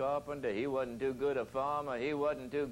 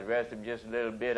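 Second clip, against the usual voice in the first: ordinary talk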